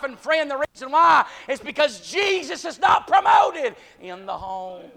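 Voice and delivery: high, loud revival sermon shout; full-force preaching. Real, not acted. Preacher warns the church about losing its young people, full-force preaching. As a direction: preach it urgently, building to a shout.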